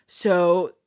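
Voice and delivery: ordinary talk, nothing marked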